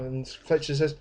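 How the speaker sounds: ordinary delivery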